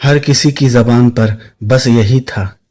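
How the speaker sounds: ordinary delivery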